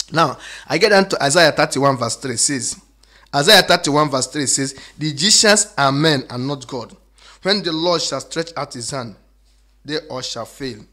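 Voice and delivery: loud, ringing scripture reading; preaching. Real, not acted. Preacher booming the verse to his congregation, loud and ringing.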